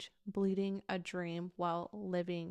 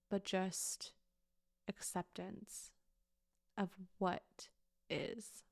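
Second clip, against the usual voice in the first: soft acceptance; 6 dB quieter, slower than usual. Real, not acted. Softening to acceptance.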